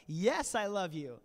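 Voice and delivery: mock-exasperated, leaning on every word; frustrated. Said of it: "he's just frustrated now".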